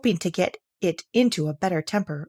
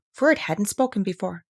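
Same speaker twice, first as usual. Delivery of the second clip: usual speaking voice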